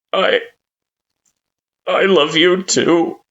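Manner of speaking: put-on halting, high child voice full of hurt; Kara, pained. A narrator's reading. Child returns parent's love, in pain. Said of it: "you can hear the pain in their voice".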